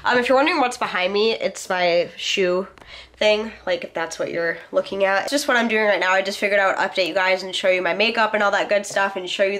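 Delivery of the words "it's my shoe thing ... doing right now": ordinary delivery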